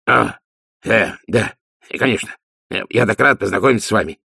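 hesitant, then a hurried gush; flustered babbling. A narrator's reading. Dwarf overawed meeting the duchess, flustered. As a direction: stammer out a greeting, caught off guard. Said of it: "пролепетал Ди".

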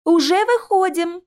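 high, drawn-out call back; shouted reply. A narrator's reading. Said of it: "крикнула в ответ Алиса". (выкрик в ответ)

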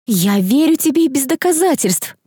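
high-pitched, emphatic assurance; firmly. A narrator's reading. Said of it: "твёрдо сказала Дейрил". (твёрдо и высоким голосом)

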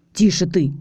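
plain reading voice

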